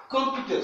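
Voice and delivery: Computer dit plus haut; English model word. Shown as a demonstration. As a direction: Computer avec que, voix haute. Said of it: "ce n'est pas com, mais que". (Computer, modèle en classe)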